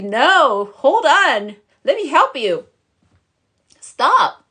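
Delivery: loud, high, urgent intervention; God. Acted. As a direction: call out to halt someone, hammed-up. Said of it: "He's like".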